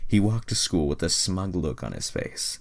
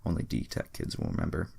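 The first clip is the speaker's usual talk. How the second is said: ordinary delivery, nothing marked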